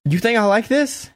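high, strained, anguished question; anguished plea. Retold or imitated. Roman defending himself to beaten Jay, anguished high plea. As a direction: cry out in guilt at him. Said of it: "He goes".

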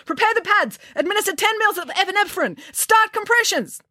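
high, urgent commands; barking orders. Acted. Young doctor to nurses with crash cart, barking orders. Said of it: "barking orders".

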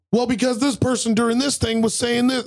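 retold kid's excuse. Kid explaining the button press to players, high mock excuse. Voices kid answering back in game.

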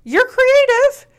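high, slow, emphatic reassurance; reassuring. Real, not acted. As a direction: insist kindly. Reassuring partner.

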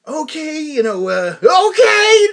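rising to a high, loud cry of okay; a mock-stunned reaction, the last okay yelped; amazed okay cracking high and loud; stunned disbelief. Stunned reaction to hearing singer.

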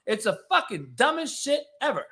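usual speaking voice

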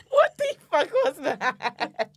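high, loud, drawn-out words through laughter; real laughing fit. Real, not acted. Co-host reacting to what the fuck that was, laughing hard. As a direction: crack up helplessly, stretching the words. Both crack up at odd noise.

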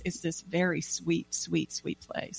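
fading to a near whisper, slow; soft and tender. Real, not acted. Coach describing clients' tears, soft and tender. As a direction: let it melt away softly. Tender emotion of self-recognition.

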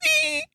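tiny, very high soft squeak; mouse squeak. Retold or imitated. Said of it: "a little mouse over here that's like".

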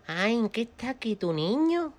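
put-on high, drawn-out old-lady voice; old woman. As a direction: coo it slowly and high as the old customer. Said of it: "llega la mujer y dice".

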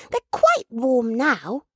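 put-on high, slow little-child voice; child's exclamation. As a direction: reassure slowly as a small child. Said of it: "exclaimed the child".

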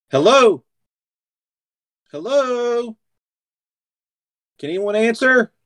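high calling voice through the mirror; calling out, hoping someone answers; Mia calling out. Acted. Mia calls through the mirror, high and searching. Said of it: "you hear Mia go".

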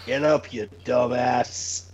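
low, slow mock insult; wrestling promo. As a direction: growl it out slowly. Mock alarm wake-up line.

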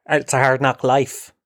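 usual speaking voice

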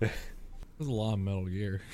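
mumbled aside. Real, not acted. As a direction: say it under your breath, barely audible. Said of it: "Wait, what was that?".

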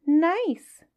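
high, sly little fish voice; small astute voice. Acted. Small clever fish flatters the whale, high sly voice. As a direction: sweet-talk the whale in a tiny clever voice. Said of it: "said the small 'stute fish".

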